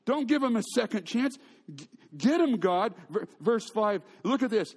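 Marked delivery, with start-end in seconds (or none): 0.0-3.0 mock scream. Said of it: "I would scream out".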